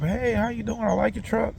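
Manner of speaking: man mimicking a woman complimenting his truck, high and friendly; high mock woman's voice. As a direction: pitch it way up like the woman greeting him. Women greet him in dream.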